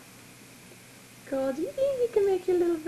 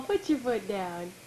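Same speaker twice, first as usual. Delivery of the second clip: ordinary delivery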